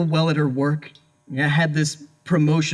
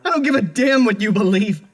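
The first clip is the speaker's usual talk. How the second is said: outraged denial; 8 semitones higher than usual. Acted. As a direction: protest your innocence in desperate fury. Accused husband protests innocence.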